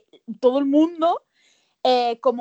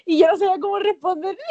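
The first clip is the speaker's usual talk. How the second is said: laughing; 6 semitones higher, 5 dB louder, faster than usual. Real, not acted. Laughing confession.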